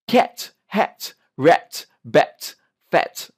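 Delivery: CAT, HAT, RAT with a released T; word drill, crisp final T on each; clear T endings. Shown as a demonstration. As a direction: finish every word on a little tss of T. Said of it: "tss, tss sound is very important".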